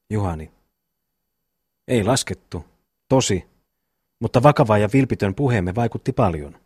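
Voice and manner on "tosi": plain reading voice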